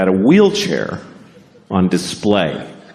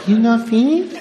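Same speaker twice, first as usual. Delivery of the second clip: put-on small, high Japanese-accented question; restrained but high-pitched Japanese-accented waitress; subtler Japanese accent; 13 semitones higher than usual. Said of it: "No, it was subtle".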